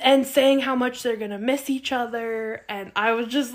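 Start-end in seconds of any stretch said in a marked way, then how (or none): none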